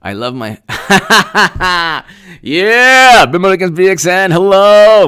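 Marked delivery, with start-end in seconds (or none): 0.7-2.0 burst of laughter. Real, not acted. Reacting to viewer messages. 2.4-5.1 high, loud gleeful yelling; excited shout-out. Real, not acted. Host hyping viewer names, loud and high.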